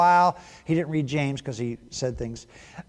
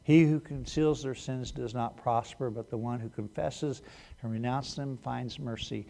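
scripture reading; 5 semitones lower, 9 dB quieter than usual. Acted. Reads scripture quietly.

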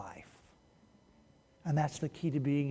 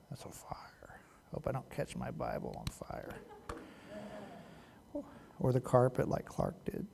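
muttered aside; 9 dB quieter than usual. Real, not acted. Muttered aside while lighting wax.

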